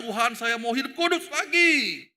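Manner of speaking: loud, high, mock-resolute cry; determined vow. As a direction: vow it emphatically, with gusto. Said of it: "Lalu kita bertekad".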